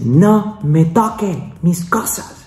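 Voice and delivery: agudo y lento, imitando el grito de la enfermera; grito de la enfermera, imitado. Retold or imitated. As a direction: remeda su orden cortante sin gritar de verdad. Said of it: "me grita". (imitación del grito de la enfermera, más aguda y lenta)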